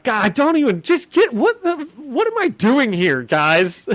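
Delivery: hammed-up annoyance; exasperated Kingpin. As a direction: throw up your hands at the idiots.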